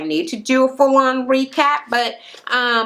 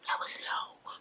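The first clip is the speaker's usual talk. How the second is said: barely breathed, much softer; fainter whisper; 19 dB quieter than usual. Real, not acted. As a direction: repeat it almost silently.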